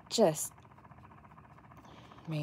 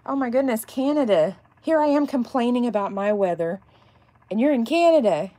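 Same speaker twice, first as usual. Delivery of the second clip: higher and louder, Canada falling; higher, amazed exclamation; 6 semitones higher, 6 dB louder than usual. Real, not acted. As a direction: marvel at the cold. Reacting to a viewer's weather.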